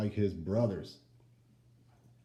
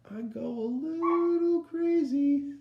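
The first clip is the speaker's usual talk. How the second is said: high, slow mock frenzy; mock crazy; 5 dB louder, slower than usual. Wolf showing how his granny makes him snap, higher. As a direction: let it go up a little crazy.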